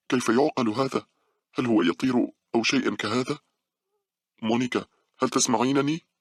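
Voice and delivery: high, frightened questioning; fearful. A narrator's reading. Girl at window begs silent friend, fearful. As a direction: plead for an answer, pitch climbing toward panic. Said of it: "بخوف وهي تقول".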